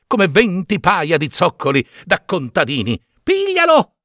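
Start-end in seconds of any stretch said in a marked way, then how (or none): 3.2-3.9 desperate high-pitched street shouting; yelling. A narrator's reading. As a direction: shout for help catching the puppet. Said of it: "urlava Geppetto".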